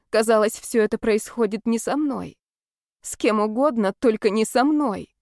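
ordinary delivery

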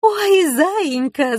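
high, drawn-out cooing; gushing delight. A narrator's reading. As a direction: coo over a sweet little animal. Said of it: "зааплодировала девушка".

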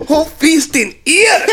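high, slow, mocking accented echo; Justin. Friend making fun of the clerk, high mimicry. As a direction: parrot the clerk in a high-pitched put-on voice. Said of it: "and Justin's all".

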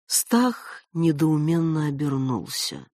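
ordinary delivery

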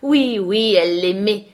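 loud, teasing chant; singsong taunt. A narrator's reading. Linton mocking furious Catherine, in singsong. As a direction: chant it to needle the cousin. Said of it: "chantonna Linton".

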